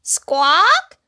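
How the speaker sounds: high, loud bird cry; seagull squawk. A narrator's reading. Noisy seagull squawking above little bear, high and loud. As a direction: screech like a gull overhead. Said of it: "went a noisy seagull".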